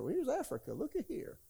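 high, soft, delighted mock-innocent voice; a hint of a wide-eyed visitor; light wonder. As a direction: marvel at it like a tourist. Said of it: "I said".

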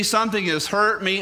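usual speaking voice

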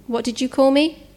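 understated alarm, rising question; shaking voice. A narrator's reading. Captive hearing her name from the stranger, mildly shaken. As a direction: ask with a touch of shaken disbelief. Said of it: "Her voice was shaking, her eyes wide".

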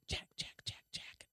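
unvoiced eerie whisper of the name; ghostly whisper. A narrator's reading. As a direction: breathe the name like a spirit in the fog. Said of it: "a voice whispered back".

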